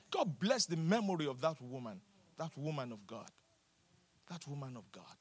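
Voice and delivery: soft, low, reverent remembrance; tender hush. Acted. Tender hushed memorial.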